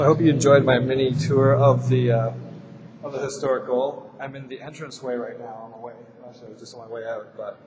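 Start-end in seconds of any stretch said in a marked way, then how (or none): none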